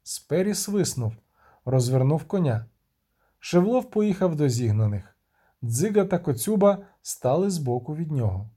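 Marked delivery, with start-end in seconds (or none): none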